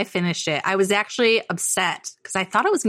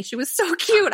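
usual speaking voice

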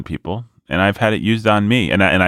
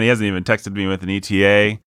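normal talking voice

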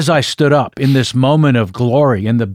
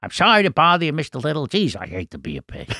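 mild Columbo voice; 4 semitones higher, 4 dB quieter than usual. Retold or imitated. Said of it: "Peter Falk".

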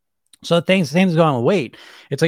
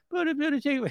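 very high, small mock whine; silly bully voice; 12 semitones higher, 6 dB quieter than usual. Inner bully still objecting, feeble high whine. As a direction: squeak the objection quietly in a put-on voice. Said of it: "there's usually this bully voice".

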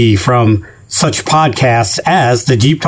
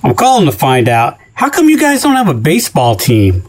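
normal talking voice

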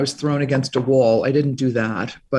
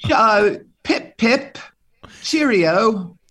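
a hint of a posh Brit saying pip pip; mock British; 6 semitones higher, much slower than usual. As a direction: toss it off like an old-fashioned Englishman. Mock British toff reply.

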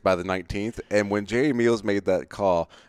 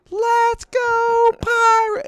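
loud, very high drawn-out cheer; piercingly high kid's chant; imitated little girl yelling; 7 dB louder, much slower than usual. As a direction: mimic a tireless little fan hollering across a stadium. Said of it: "she was yelling".

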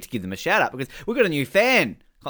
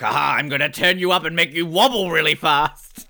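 mock villain voice; 3 semitones higher, 4 dB louder than usual. Mocking villain's line in film.

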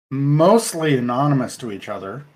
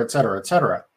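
ordinary talk

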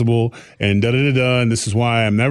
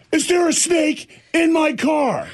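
much higher, slow, alarmed; wife's alarmed question; 14 semitones higher, slower than usual. Retold or imitated. Wife bursting in on the snake hunt, high slow alarm. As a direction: draw it out high and shocked. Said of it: "Like Hulk just, bam".

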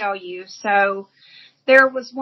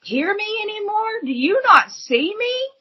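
higher, pleading cry to the heavens; crying out to God; 6 semitones higher than usual. Retold or imitated. Woman on the street crying out to God, high and pleading. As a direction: call out to God in desperation. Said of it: "I'm screaming at him".

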